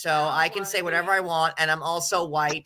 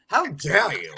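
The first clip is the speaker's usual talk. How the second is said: hammed-up fairy godmother; 3 semitones higher than usual. Continues the put-on fairy voice.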